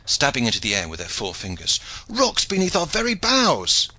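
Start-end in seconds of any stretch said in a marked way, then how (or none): 2.1-3.9 high-pitched warning cry; yelled alarm. A narrator's reading. Two shipmen on the forecastle spot rocks, yelling. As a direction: yell the danger to the whole ship. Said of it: "they yelled".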